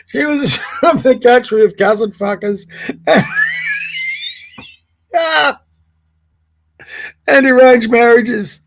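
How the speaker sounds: laughing so hard it sounds like sobbing; tears of laughter, barely getting words out; crying with laughter. Real, not acted. Reading a rude post to viewers, crying laughing.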